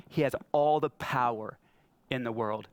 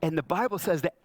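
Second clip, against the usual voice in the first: normal talking voice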